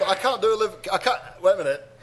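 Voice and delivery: ordinary talk, nothing marked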